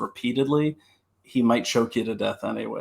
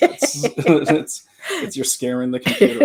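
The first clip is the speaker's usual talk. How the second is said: talking through a laugh; laughing as you tease, a bit louder; amused, words broken by laughter; 3 semitones higher, 5 dB louder than usual. Real, not acted. Laughing over a computer delay.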